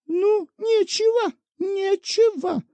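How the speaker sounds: put-on a fussy old woman taking offence, drawn out; offended old woman. A narrator's reading. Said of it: "обиделась старуха".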